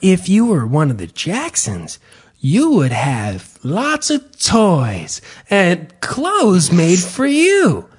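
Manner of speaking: slow, sing-song storybook voice; lilting teacher voice; kids' tour narrator. Retold or imitated. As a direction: explain it gently to children. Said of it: "They're like".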